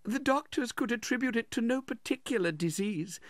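high, tearful woman's voice; tearful widow. A narrator's reading. Widow telling of her husband's death, tearful. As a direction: play the grieving mother, pitched far up. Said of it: "shedding tears".

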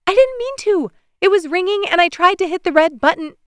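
put-on very high, childlike pleading; little girl. A narrator's reading. Daughter excusing herself to dad, high and childlike. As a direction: sound small and guilty.

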